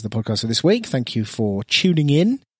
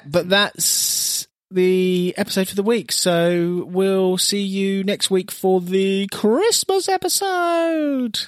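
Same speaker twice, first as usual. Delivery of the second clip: announcer sign-off; 5 semitones higher, much slower than usual. Acted.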